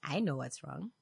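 low, very soft sly aside; acted hushed confession; knowing tattletale. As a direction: confide it quietly and low. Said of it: "It's like".

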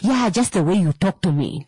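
usual speaking voice

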